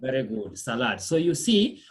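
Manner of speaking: ordinary talk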